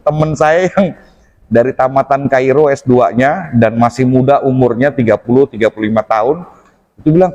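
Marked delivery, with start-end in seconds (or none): none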